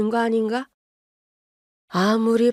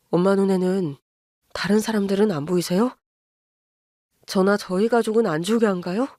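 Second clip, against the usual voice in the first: apologetic son-in-law. Acted. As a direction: hold it down, sorry but firm. Narrator voices son pleading firmly.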